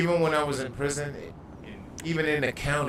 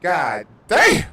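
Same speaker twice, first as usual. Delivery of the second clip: loud, high amazed yell; loud amazed exclamation about the food; amazed shout; 5 semitones higher, 12 dB louder than usual. Retold or imitated. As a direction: shout it like the best bite ever. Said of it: "I was like".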